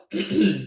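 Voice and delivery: a low gruff throat clear; a mock-important ahem. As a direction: clear the throat before speaking sternly. Innkeeper about to question.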